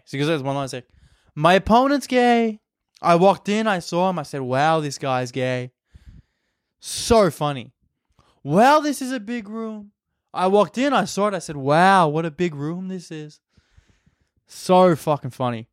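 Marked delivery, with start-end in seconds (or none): 1.4-5.7 presidential parody, describing in odd cadence; Donald Trump. Retold or imitated. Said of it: "how to do a Trump impersonation". 8.4-13.4 high, stretched-out opening, easing down; Donald Trump. Retold or imitated. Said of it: "how to do a Trump impersonation".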